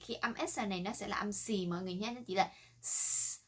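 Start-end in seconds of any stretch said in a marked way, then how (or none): 2.8-3.4 a long "sss" with no buzz; unvoiced hiss. Shown as a demonstration. Teacher contrasting it with Z, pure hissed "s". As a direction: hiss the "s" without vibrating the throat. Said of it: "không rung mọi người nhé".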